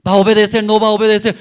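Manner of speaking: loud, high demand as a scolding father; stern father shout. Retold or imitated. Father scolding his son, loud stern demand. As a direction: bark the question at the child. Father threatens child with whip.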